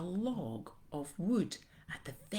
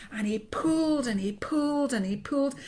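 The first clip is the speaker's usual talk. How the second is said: straining; 8 semitones higher, 6 dB louder than usual. A narrator's reading. Straining tug of war.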